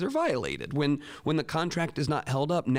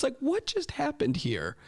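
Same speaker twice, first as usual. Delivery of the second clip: baffled reaction; 13 semitones higher than usual. Retold or imitated. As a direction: squeak it out, acting stunned. Teacher retells stunned reaction.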